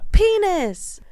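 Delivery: high put-on voice saying penis; mock Tom Cruise soundboard. Friends joking about a soundboard, high mock voice. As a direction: mimic the soundboard clip. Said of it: "playing the Tom Cruise buttons".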